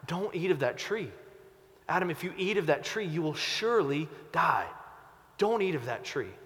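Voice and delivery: soft, pleading, tender warning; loving father. Shown as a demonstration. God gently warning Adam, soft and pleading. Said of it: "how is God talking to Adam".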